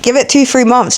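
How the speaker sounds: normal talking voice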